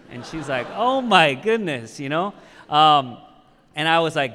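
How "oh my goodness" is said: higher, louder, drawn-out astonishment; retold amazement. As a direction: draw it out as an amazed rider would. Retold amazed reaction to pastor reveal.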